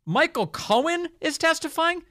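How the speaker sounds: high, mocking voice of Dan Goldman; mocking quote. Host mimics a congressman in a high mocking voice. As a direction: squeak it out as a sneering imitation. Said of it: "He said".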